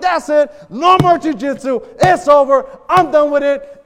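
loud, high acted ultimatum; upset outburst. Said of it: "I just got upset".

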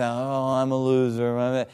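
hammed-up dejection; mock down in the dumps. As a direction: mope in a put-on glum voice. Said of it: "down in the dumps".

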